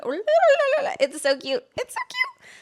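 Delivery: high-pitched squealing coo; gushing over cuteness. Real, not acted. Gushing about Pokemon that follow you, high excited squeal. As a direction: jump up high, overcome by cuteness.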